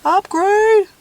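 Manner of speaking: high, loud, thrilled "upgrade"; excited exclaim. Real, not acted. Vlogger showing viewers the new frother, high and loud. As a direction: announce the upgrade with glee.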